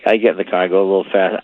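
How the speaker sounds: ordinary talk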